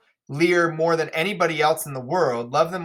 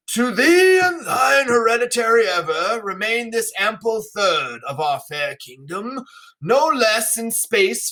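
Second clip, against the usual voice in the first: King Lear addressing daughters; 2 semitones higher than usual. Acted. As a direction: announce the gift like a proud old king. Teacher reads Lear's lines.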